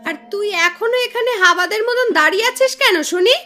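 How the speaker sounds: loud bursts of contemptuous scolding; angry, scornful refusal. Acted. Aunt refuses to feed her nephew's playmate, angry and scornful. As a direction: put the poor girl in her place.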